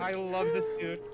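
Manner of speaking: high, gushing squeal of delight; excited squeal. Real, not acted. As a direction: squeal over something cute. Fangirling over cute lizard.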